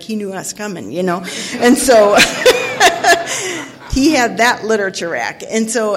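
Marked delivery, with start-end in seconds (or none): 2.2-3.9 bright burst of laughter; laughing. Real, not acted. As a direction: break into a laugh. Laughing at doctor's trick.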